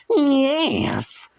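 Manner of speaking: very high strained squeal; salamander creature voice. A narrator's reading. The salamander answers Frank, squealing high. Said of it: "came the reply".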